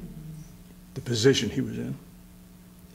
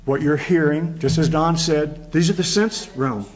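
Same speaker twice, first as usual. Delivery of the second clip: raised, rising preacher's call to the congregation; preaching at full force; 4 semitones higher than usual. Real, not acted. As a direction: build it up and lift the pitch as the church answers.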